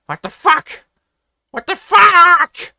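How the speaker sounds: loud, slow, high angry yell; angry confused shout. A narrator's reading. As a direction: bellow it in shocked fury. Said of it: "An angry and confused shout".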